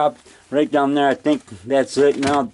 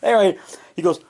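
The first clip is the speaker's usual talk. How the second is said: ordinary talk, nothing marked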